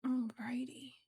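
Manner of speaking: soft whispered counting; whispering. Real, not acted. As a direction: whisper it to yourself. Whispered counting while crocheting.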